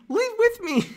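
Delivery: very high, put-on feminine falsetto; high-pitched mock heartbreak; Padme. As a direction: plead melodramatically in falsetto. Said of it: "my Padme impression?".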